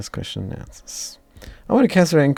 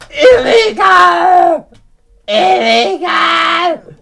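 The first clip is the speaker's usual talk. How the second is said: drawn-out, much higher, louder cries of illegal; repeated shouts; 12 dB louder, much slower than usual. Acted. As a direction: hurl it at the representative twice. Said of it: "you gotta shout".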